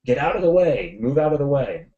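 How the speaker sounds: loud, high call to clear out; retold warning shout. Guide shouts at folks sitting by cars as boulders threaten, retold urgent shout. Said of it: "And so he shouts".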